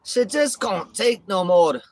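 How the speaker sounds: loud, high Scottish wail, drawn out slow; Scottish accent. Acted. Streamer trying out a character, pleading Scottish accent. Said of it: "I love Scottish accent".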